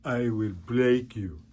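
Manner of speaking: slow, heavy Russian boxer menace; Rocky villain voice. Retold or imitated. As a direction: growl it like a cold fighter. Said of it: "even frickin' Rocky's done it".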